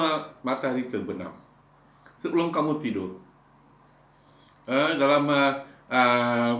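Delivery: ordinary talk, nothing marked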